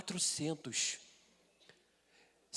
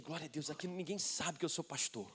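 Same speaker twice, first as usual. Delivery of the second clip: discreet murmur; 2 semitones lower, 8 dB quieter than usual. Retold or imitated. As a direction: keep it down, staying unnoticed. Secretly relieved nobody knows him.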